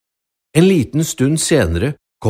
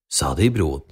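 ordinary delivery

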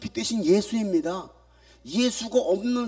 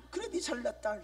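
작게 비꼬는 '네 잘났다'; 혼잣말로 빈정대기; sarcastic mutter; 2 semitones higher, 12 dB quieter than usual. Acted. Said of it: "표정들이 별로 안 좋아?". (작게 빈정대는 말투)

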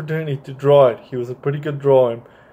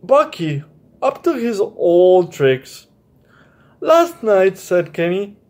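bright storybook reading voice, a bit higher; children's story narration; 5 semitones higher than usual. Acted. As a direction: read it to a child at bedtime. Reads children's story aloud.

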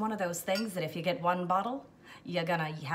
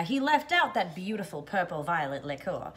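ordinary talk, nothing marked

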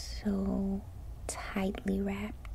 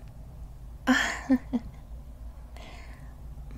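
breathy nervous laugh; 4 semitones higher than usual. Acted. Laughs after being caught.

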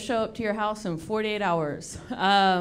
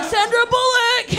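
much higher, louder cry of a name while throwing; mock battle cry; 12 semitones higher, 4 dB louder than usual. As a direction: yell it as you hurl the ball. Shot-putter's throw cry.

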